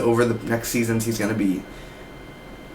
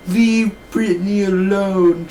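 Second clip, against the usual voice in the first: slow, higher, whiny; mocking Cameron; 8 semitones higher, 3 dB louder, slower than usual. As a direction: draw out the wrong name. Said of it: "She's literally like".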